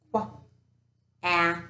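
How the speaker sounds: "Qu-a-k" split into its sounds; tapping sounds. Shown as a demonstration. Teacher tapping out a word for kids, segmented. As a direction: tap out "Qu-a-k" sound by sound. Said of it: "I'm going to tap it out".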